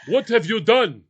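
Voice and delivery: hammed-up indignant outburst; mock upset Frenchman. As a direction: imitate an appalled French driver, word by word. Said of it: "exactly what French people do".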